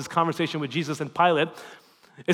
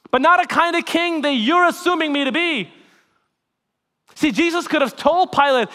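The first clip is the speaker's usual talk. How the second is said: raised, impassioned sermon delivery; preaching at full force; 9 semitones higher, 9 dB louder than usual. Acted. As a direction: preach it out to the congregation.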